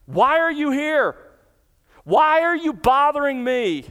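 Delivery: high, loud, hostile demand; malevolent aggression. Acted. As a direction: hurl it at Jesus like a threat. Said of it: "malevolent aggression".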